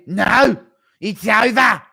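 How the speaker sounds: high, loud referee shout; Goddard impression. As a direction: yell it like a ref stopping the fight. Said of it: "Haven't heard a Goddard impression".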